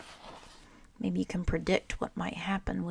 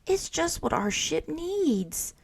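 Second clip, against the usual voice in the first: high, slow delighted exclaim; thrilled by the new flag; lifted, drawn-out delight; excited exclaim; 10 semitones higher than usual. A narrator's reading. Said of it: "exclaimed Franklin".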